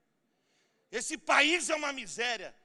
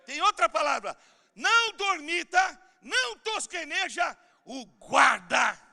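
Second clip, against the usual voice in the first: preaching shout; 4 semitones higher, 8 dB louder than usual. Real, not acted. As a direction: thunder the verse at the church. Preacher proclaiming scripture.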